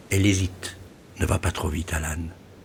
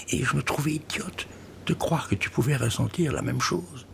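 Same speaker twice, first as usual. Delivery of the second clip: chuchoté; 7 semitones higher, 5 dB quieter than usual. Acted. Aveu intime chuchoté.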